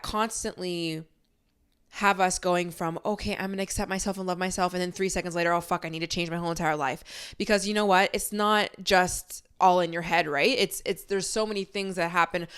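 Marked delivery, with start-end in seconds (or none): none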